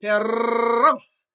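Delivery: "perros" drawn out on a long loud trill; exaggerated rolled R. Shown as a demonstration. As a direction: stretch the rolled rr of "perros" as a pronunciation model. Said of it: "you roll it".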